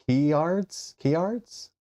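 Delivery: "Keyards" with a y glide after the k, rising high; Northern Irish pronunciation. Retold or imitated. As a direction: stretch "Keyards" as kee-ards, lifting like a question. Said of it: "North Ireland relatives say".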